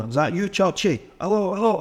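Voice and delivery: high, frustrated calling down the phone; re-enacted irritation at a bad phone line; getting angry. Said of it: "'cause I was getting angry".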